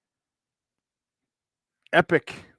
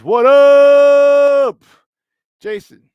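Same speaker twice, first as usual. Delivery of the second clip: long, loud, high held shout-out; big drawn-out greeting holler; calling out; 9 semitones higher, 12 dB louder, much slower than usual. Real, not acted. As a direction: holler it and stretch the "up".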